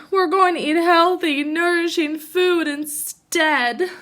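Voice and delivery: eager storybook cry. Acted. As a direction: brother cub crying out. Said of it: "cried Brother".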